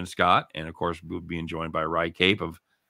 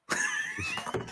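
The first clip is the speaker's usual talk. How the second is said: very high, wheezy laugh; helpless laughter; 8 dB quieter than usual. Real, not acted. Host loses it at the name joke, wheezing with laughter. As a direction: crack up until only squeaks come out.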